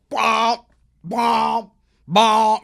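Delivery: loud, blaring vocal bell blasts; imitated alarm bell. Storyteller sounding the prison bell, imitated loud blasts. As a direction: boom out the bell, one blast at a time. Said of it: "The bell goes off".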